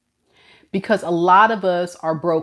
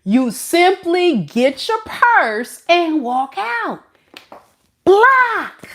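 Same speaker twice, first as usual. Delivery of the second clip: higher, slow and pointed, then a sharp high "Block"; sassy dismissive; 9 semitones higher, much slower than usual. Real, not acted. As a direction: deliver it like a diva walking out. Sassy instruction.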